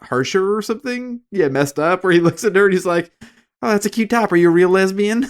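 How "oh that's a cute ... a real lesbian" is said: mock Southern accent. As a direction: put on a Southern accent to make the jab funny. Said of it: "he has the weird Southern voice".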